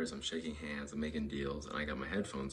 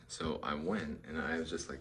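normal talking voice

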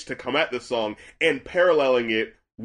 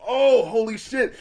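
drawn-out, higher-pitched shock; retold realization; 8 semitones higher, slower than usual. Reacting to what the lyrics really mean, retold shock. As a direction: re-enact being stunned by a discovery.

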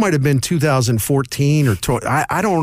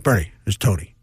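lower, gruff-toned manager calling to complain; Tony La Russa impression; 6 semitones lower than usual. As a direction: imitate the old manager leaving a grumpy voicemail, voice dropped low. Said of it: "That was a really good Tony La Russa impression".

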